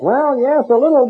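put-on high, hesitant old man voice; old man answering the healer; timid old man. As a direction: answer meekly like the deaf fella. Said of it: "And the guy said".

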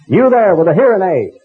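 a hammed-up evangelist hailing the old man; mock faith healer call-out. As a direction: call out to the crowd like a tent preacher. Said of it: "strongly flavored Southern accent".

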